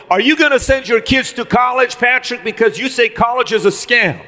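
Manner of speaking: a touch of a doubting guy from the crowd; questioning audience guy. Retold or imitated. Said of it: "A guy was asking me a question".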